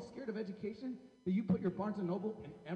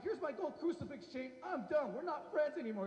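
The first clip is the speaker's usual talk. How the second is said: mock fed-up breakup, pitched higher; 5 semitones higher, 3 dB quieter than usual. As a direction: call it quits in a raised pitch. Acted breakup with old gang.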